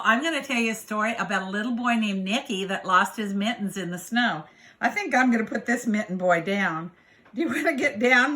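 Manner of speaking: plain reading voice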